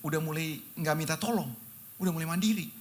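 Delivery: ordinary delivery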